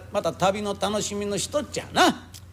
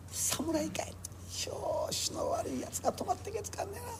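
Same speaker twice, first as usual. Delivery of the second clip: whispered, much softer, wary grumble; whispered aside; 13 semitones higher, 12 dB quieter than usual. Acted. Guest warily whispering about the samurai next door, much softer. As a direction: hush it so the samurai won't hear.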